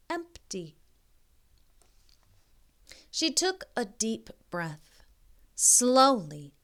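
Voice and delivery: slow storybook reading voice; story narration. A narrator's reading. Reading a picture book aloud to young kids, gentle storybook narration. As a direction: read it like a bedtime picture book.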